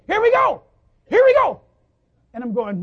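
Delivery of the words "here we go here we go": put-on very high, blaring kid cheer; fired-up little kid. Little kid as the plane taxis along, fired up. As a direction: shout it out like an overexcited child. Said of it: "And the kid goes".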